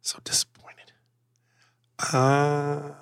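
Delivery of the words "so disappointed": soft mock-disappointed whisper; hushed, half-joking sigh of disappointment. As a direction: breathe it out, let down. Mock dismay at co-host.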